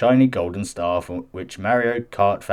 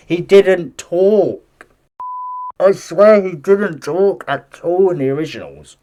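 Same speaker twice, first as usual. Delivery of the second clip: insistent; 8 semitones higher, 6 dB louder, slower than usual. Real, not acted. Emphatic insistence on Mario.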